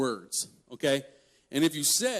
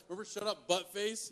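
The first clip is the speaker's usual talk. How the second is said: softer, higher needling taunt; teasing reminder; 5 semitones higher, 10 dB quieter than usual. Retold or imitated. Younger brother reminding his thirty-year-old brother, teasing reminder. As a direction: needle a sibling, a touch sing-song.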